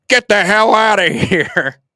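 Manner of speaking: loud, high, slow imitated player barking a joke; mock gruff yell. Player shoos a reporter away with a mock loud yell. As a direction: act out a teammate yelling a joke, laughing through it. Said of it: "talks under his, into his sweatshirt".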